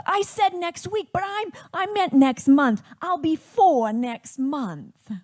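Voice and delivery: put-on loud, high-pitched small boy with childish pronunciation; little brother. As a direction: blurt it like an excited preschooler correcting himself. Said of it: "he comes on and he says".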